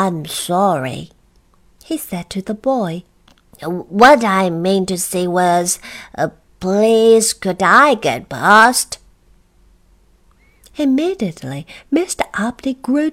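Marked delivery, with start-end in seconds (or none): 0.0-1.1 slow, lower apology; reluctant apology. Acted. Uppity forced into apology. 3.6-9.0 slow, emphatic, getting louder; laboured politeness. Acted. Uppity corrects himself, forced politeness.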